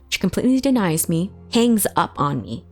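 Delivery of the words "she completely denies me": ordinary talk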